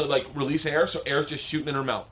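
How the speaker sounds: usual speaking voice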